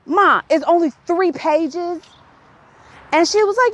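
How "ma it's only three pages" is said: higher, slow disbelieving question; mock disbelief at mom, drawn out; incredulous. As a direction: question it like you can't believe it. Retelling her incredulous call to mom.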